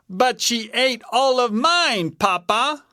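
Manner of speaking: high little bear voice; complaining Baby Bear. A narrator's reading. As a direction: complain to papa as a cub. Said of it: "said Baby Bear".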